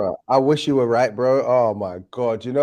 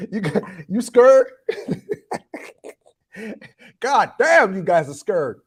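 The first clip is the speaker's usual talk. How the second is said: high teasing laugh through the words; mock them while cracking up; laughing taunt; 8 semitones higher, slower than usual. Real, not acted. Teasing England's players, laughing and high-pitched. Mocking the opponents as scared.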